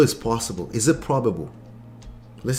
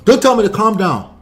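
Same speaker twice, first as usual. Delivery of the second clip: higher, louder mock indignant snap; 7 semitones higher, 8 dB louder than usual. Upset partner refusing to be calmed, mock snap. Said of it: "Yeah, like".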